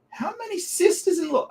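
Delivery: high, disbelieving squeak of a question; baffled by yet another sister-in-law; incredulous. Real, not acted. Streamer losing count of sisters-in-law, incredulous high squeak.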